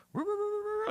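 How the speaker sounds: high, loud gator-call barking; imitated barking. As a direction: bark rapidly to call in gators. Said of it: "He was barking".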